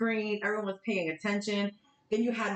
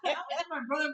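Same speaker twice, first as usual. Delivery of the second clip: high giggling words; laughing; 8 semitones higher, much faster than usual. Real, not acted. Woman laughing while recalling the video, fast and giggly. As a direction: talk fast through real laughter.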